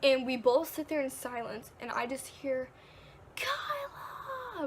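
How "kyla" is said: high, far-off yelled name, lightly voiced; imitating aunt calling close by; aunt's call. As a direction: call out the name from nearby woods. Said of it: "but, like, in my aunt's voice".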